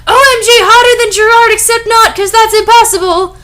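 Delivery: high, loud, fast squealing gush; gushing salesperson. Acted. A salesperson fawning over the narrator, fast high squeal. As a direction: gush at her like a starstruck clerk.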